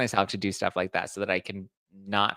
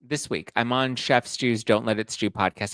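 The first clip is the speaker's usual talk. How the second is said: usual speaking voice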